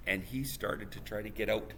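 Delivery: ordinary delivery, nothing marked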